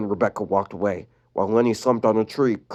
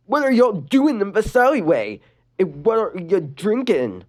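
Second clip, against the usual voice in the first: Luna voice; 8 semitones higher than usual. Acted. Luna asking.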